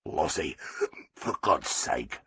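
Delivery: low, soft, slow mutter. A narrator's reading. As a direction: mutter it under your breath, embarrassed. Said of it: "Arthur Rubrick muttered".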